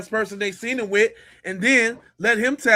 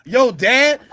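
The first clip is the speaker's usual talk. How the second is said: normal talking voice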